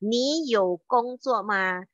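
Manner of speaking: "Ni you gongzuo ma?" said slowly, word by word; slow Mandarin example. Shown as a demonstration. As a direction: model the question slowly for learners. ("Ni you gongzuo ma?" diucapkan pelan kata demi kata)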